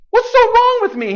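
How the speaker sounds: God as a wounded husband, high and pleading; hurt plea. Acted. God pleading with His faithless people, hurt and high. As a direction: cry it out like a hurt lover. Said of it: "he's saying".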